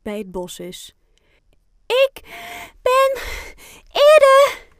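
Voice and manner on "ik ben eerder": very high and loud, word by word; triumphant shout. A narrator's reading. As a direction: shout each word separately, like a race winner. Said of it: "roept Fien wanneer ze het paaltje". (triomfantelijk geroepen, woord voor woord)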